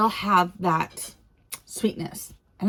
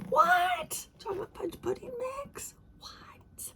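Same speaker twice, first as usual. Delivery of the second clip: squeaky high disbelief falling to a whisper; high surprised squeal then whispered disbelief; 8 dB quieter than usual. Real, not acted. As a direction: gasp in shock, then fade to a hushed "What?". Surprise at pudding mix.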